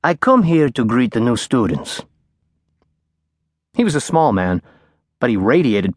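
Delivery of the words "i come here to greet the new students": a lightly voiced old professor, soft and lilting; gentle sing-song lilt; soft, lilting old lecturer. A narrator's reading. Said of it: "he said in a soft, sing-song voice".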